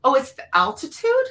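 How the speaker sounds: incredulous imitation of an excuse; mocking disbelief. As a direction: parrot a lame excuse in disbelief. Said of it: "That's what they're saying".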